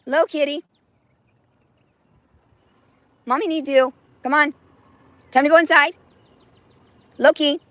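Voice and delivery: high, loud calling a cat. Real, not acted. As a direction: call the cat from the door.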